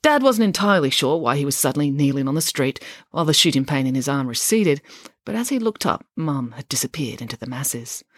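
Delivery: usual speaking voice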